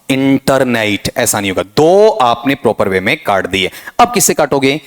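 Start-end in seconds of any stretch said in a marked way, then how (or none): 0.1-1.1 इंटरनेट as "in-tar-nait", r pronounced; इंटरनेट with "tar" in the middle and "nait" at the end; wrong, sounded r. Shown as a demonstration. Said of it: "इसमें अर sound".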